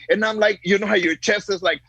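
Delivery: ordinary talk, nothing marked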